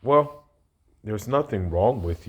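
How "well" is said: a high, loud well that cuts in; exclaimed well. Real, not acted. Cutting off the woman in the clip with a loud, high well. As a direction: jump in with a pitched-up well.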